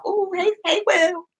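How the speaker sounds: high, playful come-on; mock flirting. Fan pretends to flirt with the star, playfully. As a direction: coo a joking hello to a crush.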